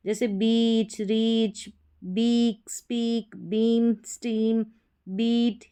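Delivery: बीच, बीट, मीट each said with a long ee. Shown as a demonstration. Teacher modelling long ee for students, each word slow. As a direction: stretch the ee in बीच and हीट. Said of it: "बड़ी ई के रूप में".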